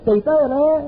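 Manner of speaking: high mocking taunt of the little voice; little inner voice. The little inner voice mocking a scared man, high taunting. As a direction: taunt him like a sneering inner voice. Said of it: "ta petite voix qui vient en dessous de ta cagoule".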